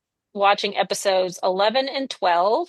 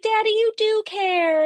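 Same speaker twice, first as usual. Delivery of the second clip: put-on high, slow childish daughter; mock sweet child; little girl voice; 10 semitones higher than usual. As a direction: coo it like a small kid.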